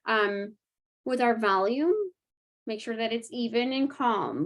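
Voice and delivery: usual speaking voice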